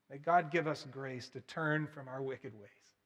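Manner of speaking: ordinary delivery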